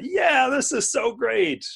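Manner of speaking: high, slow, over-sweet sarcasm; fake enthusiasm. Paul pretending delight at an unwanted gift, sarcastic. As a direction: gush falsely over the gift. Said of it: "a gift where he's saying".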